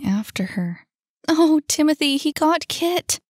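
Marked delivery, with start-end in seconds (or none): 1.3-3.2 distressed cry. Acted. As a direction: cry out the bad news, pitched high. Distressed girl reports friend caught.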